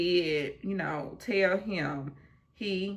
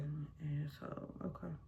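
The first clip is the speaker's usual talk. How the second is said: under the breath, much softer; muttered aside; 2 semitones lower, 15 dB quieter than usual. Retold or imitated. Reacting to a rude answer, quietly muttered insult. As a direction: mutter it so he can't hear.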